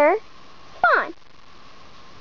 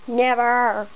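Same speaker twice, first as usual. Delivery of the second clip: much lower second character voice; low-voiced refusal; 6 semitones lower than usual. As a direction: drop low for a firm refusal. Same word answered in low voice.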